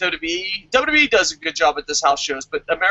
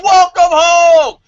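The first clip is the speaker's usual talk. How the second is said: slow, drawn-out yell, much higher and louder; screamed welcome; 10 semitones higher, 10 dB louder, much slower than usual. Retold or imitated. Fan screaming a welcome at his hero wrestler, a slow big shout. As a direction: belt it across the arena at the wrestler. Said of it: "I screamed at M V P".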